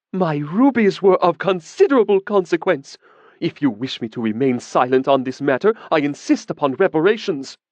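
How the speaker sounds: jab each word home; a woman furiously demanding reparations from the mage; indignant demand. A narrator's reading.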